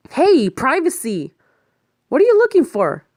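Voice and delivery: high, loud offended shout; offended at her going through his bag; indignant protest. Acted. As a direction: snap at her to stop.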